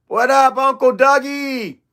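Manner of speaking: high, drawn-out greeting; hyped hello, stretching the name; hearty shout-out. Real, not acted. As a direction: call out to a friend just arriving. Calls out greeting to arriving viewer.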